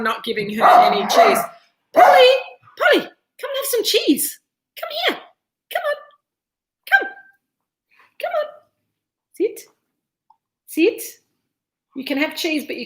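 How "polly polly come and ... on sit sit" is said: high coaxing call to a pet; calling the dog. Real, not acted. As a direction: coax the dog over in a high voice. Calling the dog over for cheese.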